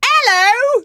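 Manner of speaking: very high Cockney hello; hammed-up high Cockney; mock Cockney. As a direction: squeal the greeting in Cockney. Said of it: "when I do that".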